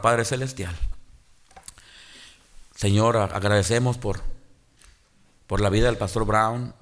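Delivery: low, earnest prayer voice. Acted. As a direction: pray aloud for the late pastor. Leads a funeral prayer.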